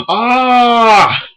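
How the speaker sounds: high, loud sudden shriek; shrill shriek. Real, not acted. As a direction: let out a startled yelp.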